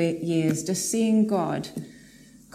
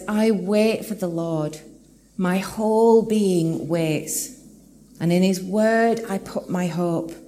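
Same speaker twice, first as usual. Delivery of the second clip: slow, weighty reading of the psalm; measured preacher's reading voice; scripture reading; 3 dB louder, slower than usual. Acted. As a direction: read each line as a solemn promise. Pastor reads psalm aloud slowly.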